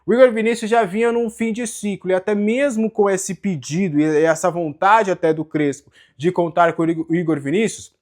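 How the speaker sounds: usual speaking voice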